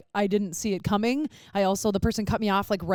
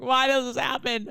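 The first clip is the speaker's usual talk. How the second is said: higher, whiny imitation of crying; mock sobbing; 4 semitones higher than usual. Driver imitating herself sobbing in the car, mock tearful. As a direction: act out a tearful wail, lightly. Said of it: "me sobbing in the car".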